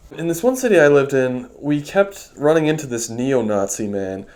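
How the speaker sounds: ordinary talk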